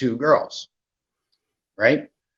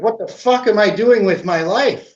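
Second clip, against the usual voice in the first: higher and louder, a self-directed outburst; exasperated at himself; 8 semitones higher, 4 dB louder than usual. Retold or imitated. Telling friends how it hit him, exasperated and higher. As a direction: throw the question at yourself in disbelief. Retold moment of self-disgust.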